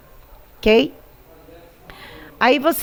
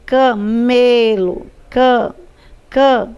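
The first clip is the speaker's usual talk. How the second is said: "Camelo" very slowly, broken into ca, me, lo, with "me" held; syllable by syllable; 3 dB louder, slower than usual. Shown as a demonstration. Teacher helping pupils find the tonic "me", slow syllable-by-syllable "camelo". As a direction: stretch and separate each syllable of "camelo", leaning on "me". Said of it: "a sílaba tônica é me".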